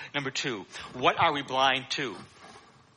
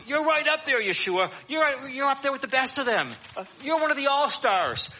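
higher, eager praise; flattering crowd; 9 semitones higher than usual. Retold or imitated. People praising Yeshua as a great sage, higher and eager. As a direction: gush over him, pitched up. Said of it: "They're saying".

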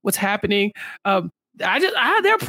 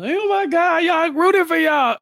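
excited cheering; 8 semitones higher, slower than usual. Retold or imitated. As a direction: cheer them on like a fan at a game. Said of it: "I was fist pumping".

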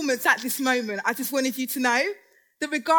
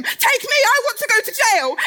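eager pleading; 7 semitones higher, 5 dB louder than usual. Acted. Begging to be cast.

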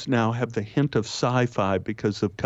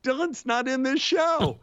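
much higher, baffled crew voice; crew member objecting; 13 semitones higher than usual. Retold or imitated. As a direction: ask it high as though nobody has heard the name. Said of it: "And they go".

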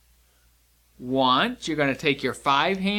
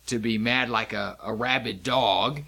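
ordinary talk, nothing marked

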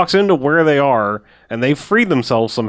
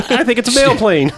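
laughing mock quote; 10 semitones higher than usual.

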